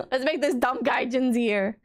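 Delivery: giggling mock staff voice; imitating the owl staff while laughing; laughing through it. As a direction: laugh out the line. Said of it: "they were like".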